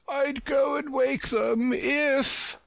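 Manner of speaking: high, slow, drawn-out shout; proud shout. Acted. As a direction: stretch it out in a haughty shout. Proud messenger shouting back.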